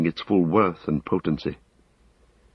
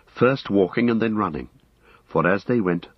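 plain reading voice

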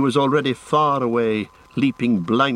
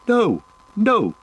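high-pitched cry of refusal; alarmed cry; 11 semitones higher, 4 dB louder than usual. A narrator's reading. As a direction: cry out in protest, pitch leaping up. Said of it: "cried Frodo".

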